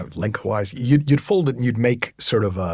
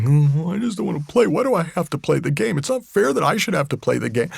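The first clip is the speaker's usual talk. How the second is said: higher, whining complaint; whiny victim voice; 8 semitones higher, 4 dB louder than usual. Retold or imitated. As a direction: whine like a sulky child. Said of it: "that felt kind of victim-y".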